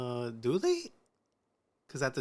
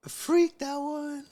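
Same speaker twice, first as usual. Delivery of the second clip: much higher, louder mock squeal; 13 semitones higher, 4 dB louder than usual. Real, not acted. Friend squealing about her freaky dad. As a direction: squeal it in disbelief.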